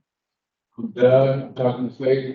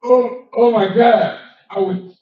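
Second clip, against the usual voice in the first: usual speaking voice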